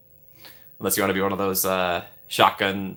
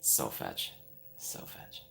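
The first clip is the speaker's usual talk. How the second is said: whispered aside; 17 dB quieter, much slower than usual. Pretend.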